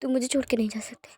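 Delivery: whispered, broken plea; a whispered sob of a plea; tearful whisper. Acted. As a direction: breathe the words out through tears. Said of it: "आंसू बह रहे होते हैं".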